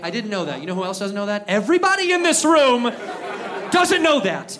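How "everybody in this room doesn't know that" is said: high, loud comic outburst; exasperated rant, much louder; exasperated yelling. Acted. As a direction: shout it at the whole room.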